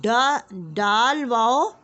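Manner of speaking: slow, loud, stretched letter-by-letter reading; phonics drill. Shown as a demonstration. Teacher drilling KG class on joining letters with वाव. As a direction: sound out each letter pair for small children. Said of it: "तो".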